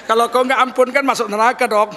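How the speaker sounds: mock plea tipping into laughter; acted crazed, unrepentant laughter; crazy laughing prayer. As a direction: challenge God lightly, then laugh madly. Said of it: "Ah, itu gilanya itu".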